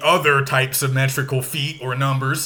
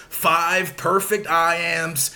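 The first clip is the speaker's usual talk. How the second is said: ordinary talk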